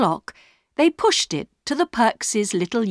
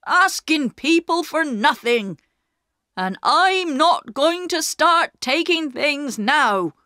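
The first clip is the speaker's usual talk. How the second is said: proud father refusing charity, voice raised; angry shout; 6 semitones higher than usual. A narrator's reading. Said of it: "they heard Perks shout".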